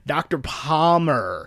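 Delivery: "Palmer" drawn out slowly with a heavy R; slow "Doctor Palmer", American R overemphasized; overdone American R. Retold or imitated. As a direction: imitate an actor leaning hard on the R in "Palmer". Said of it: "you gotta really hit the R there".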